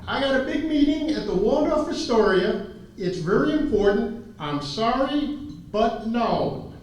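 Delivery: imitated big-shot oilman, high and insistent; high-pitched impression, emphatic refusal; Mike. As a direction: mimic a busy man firmly turning someone down. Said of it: "That sounds like Mike".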